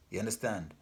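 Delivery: ordinary delivery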